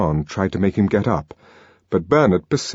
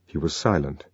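ordinary delivery

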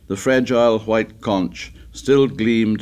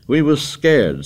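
high, loud, drawn-out insistence; excited; 7 semitones higher, 5 dB louder than usual. A narrator's reading. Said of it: "said Piggy excitedly".

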